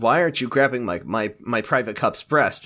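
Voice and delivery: ordinary delivery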